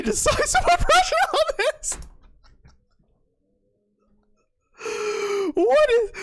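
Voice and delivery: very high, squealing disbelief; high fan shriek at the board; shocked outburst. Real, not acted. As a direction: lose control in astonishment. Shocked by unexpected move.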